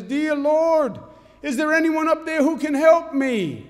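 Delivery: high, loud cry for help; high loud hammed-up desperate prayer; desperate plea. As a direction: beg heaven from a cliff branch. Falling man cries to heaven.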